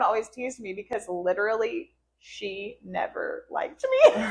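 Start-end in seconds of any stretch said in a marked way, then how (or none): 3.5-4.3 high burst of laughter; giggling at the memory; laughing. Real, not acted. Teased that the child disliked her.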